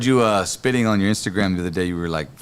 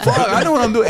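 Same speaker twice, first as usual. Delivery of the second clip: put-on indignant drunken protest; 11 semitones higher than usual. Retold drunk protest to cop.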